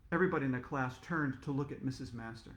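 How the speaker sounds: ordinary delivery, nothing marked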